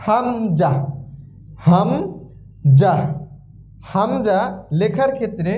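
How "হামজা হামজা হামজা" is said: হামজা said with a glottal catch, drawn out; teacher models হামজা slowly for students; demonstrated letter sound. Shown as a demonstration. Arabic teacher modelling হামজা sound. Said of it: "আ উচ্চারণ আসে".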